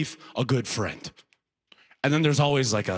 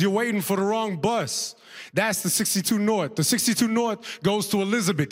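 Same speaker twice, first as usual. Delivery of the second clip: higher-pitched, confident buddy voice; confident first guy; 5 semitones higher than usual. Acted. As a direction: play the reliable, friendly guy, pitched up. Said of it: "reliable, confident, comic relief, a good friend".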